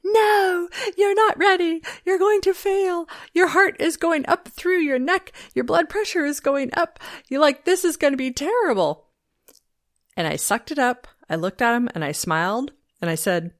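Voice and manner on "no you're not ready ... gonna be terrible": much higher, louder panicked inner voice; inner scream. Retold or imitated. Her fears screaming at her before the interview, loud panic. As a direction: shout down your own nerves. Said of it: "everything inside of me was screaming".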